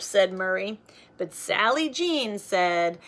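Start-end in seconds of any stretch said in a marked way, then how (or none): none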